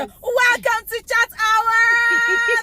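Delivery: high, loud, drawn-out show welcome; excited host belting out the greeting. Real, not acted. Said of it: "She's very excited".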